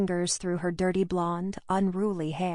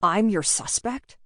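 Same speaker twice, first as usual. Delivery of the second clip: slow, louder, indignant question; escalated anger; 2 semitones higher, 5 dB louder than usual. A narrator's reading. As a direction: stretch it out in angry disbelief. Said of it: "His anger escalated with each word".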